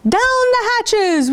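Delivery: high hammed-up "Down the hatches!", drawn out; mock pirate shout. Crew answering the captain at dinner, high mock shout. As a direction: bellow it back like the whole crew. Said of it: "we all shouted".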